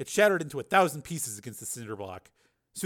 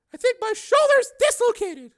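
high, loud, pained female voice; hurting woman; 11 dB louder than usual. Acted. Susan clutching her shoulder, in pain. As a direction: force it out through pain.

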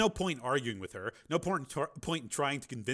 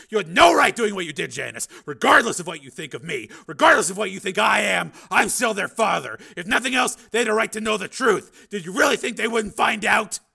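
loud, higher, heated scolding; angry tirade; 10 semitones higher, 15 dB louder than usual. Acted. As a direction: Steele berating Janice for hiding the truth, heated loud tirade. Steele berates ex-wife.

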